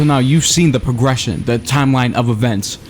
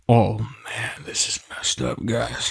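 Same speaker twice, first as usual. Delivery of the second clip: whispering; 9 dB quieter, slower than usual. Acted. Whispers in creepy level.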